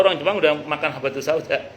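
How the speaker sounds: ordinary talk, nothing marked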